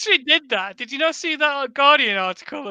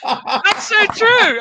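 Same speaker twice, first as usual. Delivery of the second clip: high, drawn-out words through laughter; helpless real laughter carrying into the words; laughing; 8 semitones higher, much slower than usual. Real, not acted. Co-host cracking up at a friend's slip, laughing.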